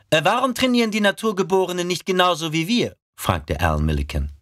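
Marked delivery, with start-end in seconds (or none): 0.1-3.0 quick, higher, puzzled question; puzzled recruit. Acted. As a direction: blurt it out like a confused recruit. Rekrut fragt verwundert. (verwunderter Rekrut)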